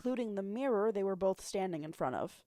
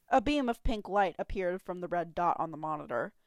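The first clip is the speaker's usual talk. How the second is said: ordinary delivery, nothing marked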